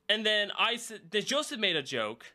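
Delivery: ordinary talk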